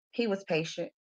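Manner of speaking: ordinary delivery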